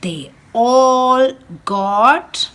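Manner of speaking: lively storybook read-aloud for a class; animated picture-book reading; reading to children. Acted. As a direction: read it like storytime for little ones. Teacher reads picture book aloud.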